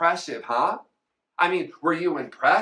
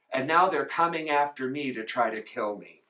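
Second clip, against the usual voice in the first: usual speaking voice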